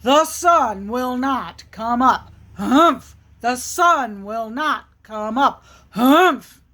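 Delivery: emphatic bear chant with punched humphs; grumpy, insistent storybook bear; stubborn bear. Acted. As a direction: declare it firmly, ending in a loud huff. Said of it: "the bear is saying".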